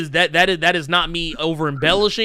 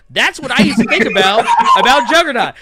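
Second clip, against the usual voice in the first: louder words broken by laughter; laughing; 4 semitones higher, 7 dB louder than usual. Real, not acted. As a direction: laugh through the punchline. Laughing at his own joke.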